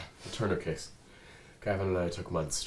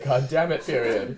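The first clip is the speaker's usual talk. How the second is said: mock exasperated read; 8 semitones higher, 11 dB louder than usual. Real, not acted. Mocking the script line.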